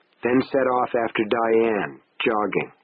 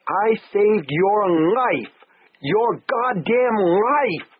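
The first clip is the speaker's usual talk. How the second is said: high, slow anguished shout; 9 semitones higher, much slower than usual. Acted. Husband shouts after departing wife, slow high anguished shout. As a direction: hurl it down the street at her. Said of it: "I shouted it again".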